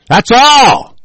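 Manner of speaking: sudden angry yell. Real, not acted. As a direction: bark it out in anger. Repeats phrase, then yells it.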